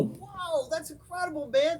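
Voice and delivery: hushed, very high-pitched wow; exaggerated high impressed reaction; mock amazement. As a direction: gush softly in a high voice. Mock-impressed reply to young cousin.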